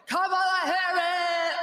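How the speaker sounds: stretched hammed-up announcer yell; mock Oprah yell. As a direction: belt the name out like a showy host. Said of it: "You get Oprah yelling at you".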